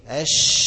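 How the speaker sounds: أش blown out loud with spreading air. Shown as a demonstration. Teacher demonstrating the spreading sh sound. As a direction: push a strong burst of air on أش. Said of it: "زي ما قلت لحضراتكم قبل كده".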